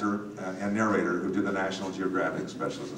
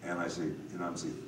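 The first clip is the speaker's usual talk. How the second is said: normal talking voice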